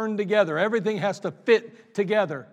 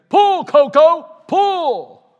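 loud, much higher, slow drawn-out command; farmer commanding; 9 semitones higher, 13 dB louder, much slower than usual. Acted. Farmer commanding his horse a third time, loud and drawn-out. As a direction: stretch the horse's name out in a big call. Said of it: "he commanded".

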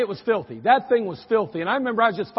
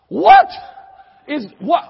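high, loud startled cry of a mother; a mom shrieking at her filthy son; shocked shriek; 7 semitones higher, 13 dB louder, slower than usual. Retold or imitated. Mother seeing her dirt-covered son from the window, shrieking in shock. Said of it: "she's like".

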